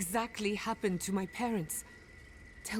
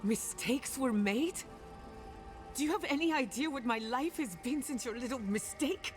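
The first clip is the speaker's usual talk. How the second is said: higher-pitched, incredulous echo building into accusation; wounded, rising indignation; indignant outrage; 8 semitones higher than usual. Acted. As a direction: throw the excuse back at them, pitch raised. Confronts spirits over ruined family.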